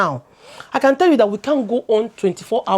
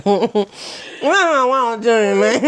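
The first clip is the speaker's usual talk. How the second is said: sulky nasal reply; slower than usual. Pretend. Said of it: "answer through my nose".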